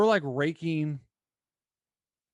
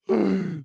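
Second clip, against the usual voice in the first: gasp; 6 semitones higher, 5 dB louder than usual. Retold or imitated. Girl's lawyer dad story.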